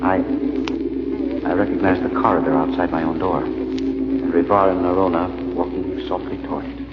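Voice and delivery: hushed, unhurried storytelling; story narration. A narrator's reading. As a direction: draw the listener into the dream. Narrator bridges into dream.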